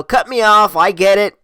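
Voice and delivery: loud, high, worked-up concession; retold shouted giving-in; exasperated pleading. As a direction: throw it after her loudly. Still pleading while chasing her.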